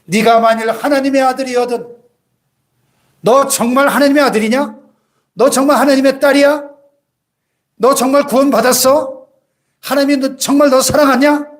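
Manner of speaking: lightly voiced taunting devil; devil whispering doubt. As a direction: needle them with doubt like the tempter. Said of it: "우리 귓가에 속삭입니다".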